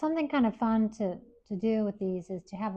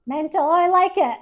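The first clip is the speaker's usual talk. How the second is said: higher and louder, thrilled; light up with real delight; teacher delighted by a student's one-word answer; 7 semitones higher, 12 dB louder than usual. Real, not acted.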